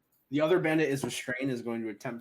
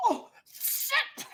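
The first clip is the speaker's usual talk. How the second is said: very high acted yelp; bandit's shocked cry. Bandit whose arm is cut off, shocked high cry. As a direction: cry out as if just struck.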